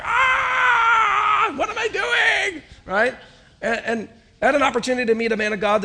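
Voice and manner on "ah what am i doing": high, loud anguished cry of panic; panicked inner cry. Retold or imitated. Pastor retelling his panic before graduation, loud high shout. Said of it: "I was like".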